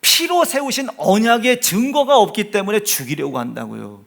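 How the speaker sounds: loud, driving sermon delivery; insistent pulpit proclamation; forceful preaching. Acted. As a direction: preach it with force, punching key words.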